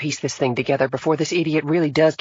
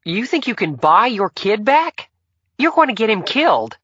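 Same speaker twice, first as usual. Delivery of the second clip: angry outburst; 6 dB louder than usual. A narrator's reading. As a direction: lose your cool at the father. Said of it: "seemed to have lost hers".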